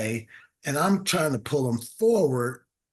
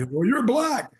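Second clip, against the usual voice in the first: imitated high-pitched blunt statement; matter-of-fact; 11 semitones higher, 4 dB louder than usual. Older clerk answering the man matching his skin, matter-of-fact. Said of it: "he says it so matter-of-factly".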